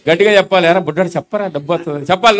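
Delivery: normal talking voice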